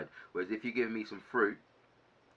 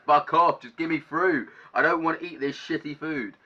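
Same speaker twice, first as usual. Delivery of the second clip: louder, higher, fed-up snap; angry outburst; 6 semitones higher, 8 dB louder than usual. Real, not acted. Venting about prison food, angry louder outburst. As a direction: blast it out like you've had enough. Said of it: "I got so angry".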